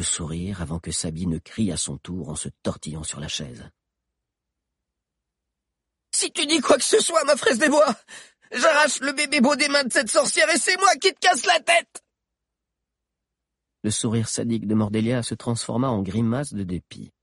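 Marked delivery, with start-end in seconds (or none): 6.1-12.0 put-on very high, loud girl threat; fierce girl. Sabi threatens Valère. (menace de fillette, très aiguë et forte)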